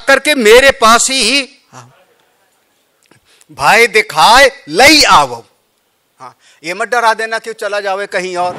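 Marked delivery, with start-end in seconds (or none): 3.5-5.4 भय दिखाए लई आवो slow and loud, weight on लई; stressed verse line. Shown as a demonstration. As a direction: lean on लई, slowly. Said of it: "पंक्ति पर ध्यान देना".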